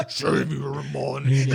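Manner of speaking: possessed. Retold or imitated. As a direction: intone it like a possessed demon. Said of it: "[name] for ten hours in demonic voice".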